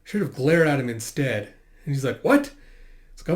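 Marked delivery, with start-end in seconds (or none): 2.2-2.5 high, loud yelp of disbelief; acted startled outburst; shocked what. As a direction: react as if scandalised. Acted shocked reply to a remark.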